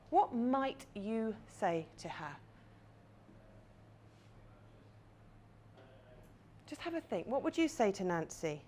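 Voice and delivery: quiet facilitator guiding an imagined scene; guided visualisation. Acted. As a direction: lead them gently into the scene.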